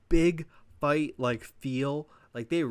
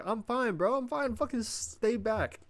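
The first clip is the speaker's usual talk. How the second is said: much higher, squeaked I'm fine; mock high insistence; 9 semitones higher, slower than usual. Vlogger mocking The Rock, high and squeaky. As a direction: squeak out a proud brush-off. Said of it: "The Rock's like".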